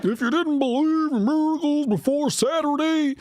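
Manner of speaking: high-pitched mock-preacher Midwest accent. Host mimics senator's miracles line, mocking Midwest accent.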